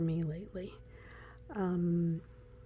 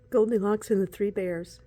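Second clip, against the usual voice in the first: gasp, then a louder delighted name; thrilled discovery; excited gasp; 7 dB louder than usual. Real, not acted. Recognizes the card excitedly.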